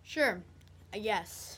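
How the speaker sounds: low, soft, unenthusiastic reply; unexcited. Acted. Babysitter answers girl's Christmas question, unexcited. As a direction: go along with no interest at all. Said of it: "You don't sound very excited".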